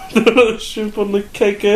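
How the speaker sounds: put-on high-pitched joke Australian accent; mock Australian accent. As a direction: play up a silly Aussie accent. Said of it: "it's all Australian".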